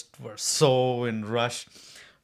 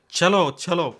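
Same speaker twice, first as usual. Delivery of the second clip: higher-pitched insistent chant; urging; 6 semitones higher, 4 dB louder than usual. Retold or imitated. Finally pushing the stalled driver to go, urgent. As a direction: press the driver to get moving.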